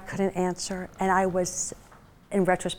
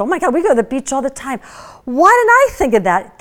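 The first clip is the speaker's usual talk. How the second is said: fast, loud, bubbly delight rising higher; excited gushing; 4 semitones higher, 8 dB louder than usual. Retold or imitated. Buyer gushing to inventor over the packaged product, excited. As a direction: gush like you just found the perfect product.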